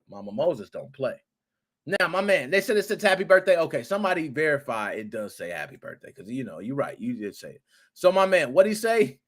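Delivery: ordinary delivery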